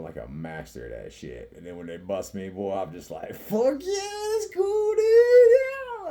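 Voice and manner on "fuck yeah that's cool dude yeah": very high, drawn-out holler; over-the-top falsetto cheer; whooping approval. Acted. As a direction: sing it out in a high gleeful yell. Acts out cheering at being busted.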